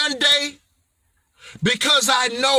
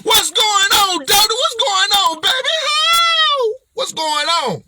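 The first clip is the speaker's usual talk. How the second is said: hyped greeting to product; 7 semitones higher than usual. Shown as a demonstration. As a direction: hype up the product like a long-lost baby. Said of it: "This is how King Rowe talk to his products".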